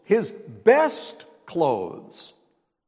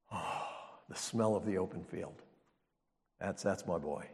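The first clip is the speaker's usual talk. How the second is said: much softer, lower, savouring; tender old father; 9 semitones lower, 11 dB quieter than usual. Retold or imitated. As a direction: breathe it in like a fond old father. Blind father savouring son's smell.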